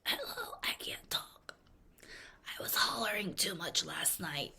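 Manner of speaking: whispered, voiceless and strained; lost voice whisper. Retold or imitated. Fan the day after the concert, voiceless whisper. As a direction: whisper as if your voice is gone. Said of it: "And then the next day".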